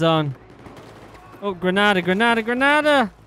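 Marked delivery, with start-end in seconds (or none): 1.4-3.1 loud urgent grenade call; grenade warning yell. Real, not acted.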